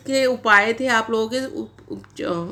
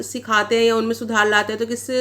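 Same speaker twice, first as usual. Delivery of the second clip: normal talking voice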